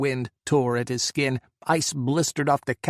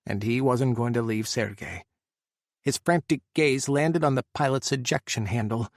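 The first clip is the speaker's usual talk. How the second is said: ordinary delivery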